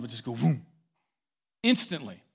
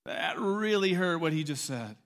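stung hum sliding into slow hurt words; mock-wounded wife, drawn out; wounded reaction; 5 semitones higher, slower than usual. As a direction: take it as a slow-sinking hurt. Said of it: "her hurt meter just went".

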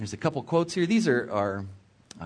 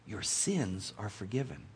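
low, soft, slow pronouncement; gentle forgiveness; 5 semitones lower, 11 dB quieter than usual. A narrator's reading. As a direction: grant forgiveness softly and slowly. Jesus forgiving the paralytic, read aloud.

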